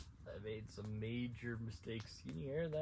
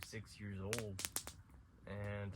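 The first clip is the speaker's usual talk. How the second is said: usual speaking voice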